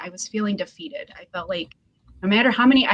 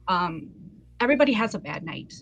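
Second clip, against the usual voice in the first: ordinary talk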